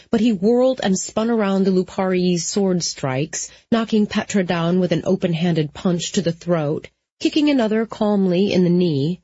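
ordinary delivery, nothing marked

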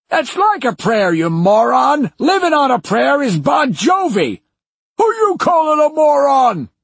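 put-on high loud cranky old man squabble; bickering old man shouting. Old men bickering loudly.

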